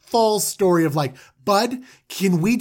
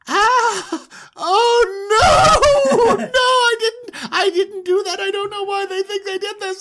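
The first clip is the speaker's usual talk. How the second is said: much higher, louder hammed-up sobbing protest; panicked crying Benjamin; 4 dB louder than usual. Benjamin caught with the cup, hammed-up high sobbing. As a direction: wail your innocence in a mock panic. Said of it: "And he's like".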